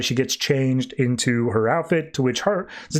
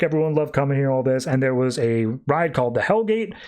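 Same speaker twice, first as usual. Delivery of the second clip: ordinary talk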